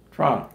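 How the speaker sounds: "Toronto" squashed to Tronno, the middle T dropped; local Tronno. Shown as a demonstration. Husband showing his wife how locals say it, clipped. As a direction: clip "Toronto" to two syllables, Tronno. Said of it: "nobody goes to Toronto".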